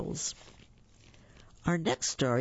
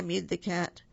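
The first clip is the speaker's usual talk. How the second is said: plain reading voice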